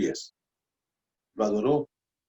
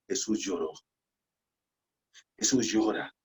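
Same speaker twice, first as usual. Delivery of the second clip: much softer, nearly a whisper; hushed; 4 semitones lower, 7 dB quieter than usual. Real, not acted. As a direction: let it fall quiet and heavy. Key verse said twice, hushed then loud.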